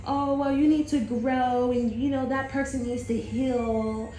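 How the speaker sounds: high, drawn-out spiritual-guide voice; mock gentle healer. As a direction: put on a slow, high-pitched, preachy healer. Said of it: "I tried doing a whole".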